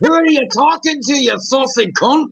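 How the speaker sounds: high-pitched indignant Brit; mock British outrage, pitched right up; British accent. Challenging someone for laughs, high indignant British accent. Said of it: "a really good British accent".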